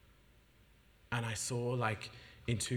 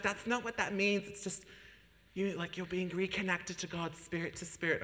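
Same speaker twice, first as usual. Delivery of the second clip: friend explaining; 9 semitones higher than usual. Retold or imitated. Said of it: "she's like".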